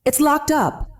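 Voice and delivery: high, crying-out lament. A narrator's reading. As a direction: cry it out to the son. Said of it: "I cried".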